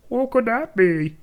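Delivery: high, loud put-on curiosity; exaggerated high-pitched wondering; mock wondering. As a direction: sing out the fake question. Mock wondering about a plot hint.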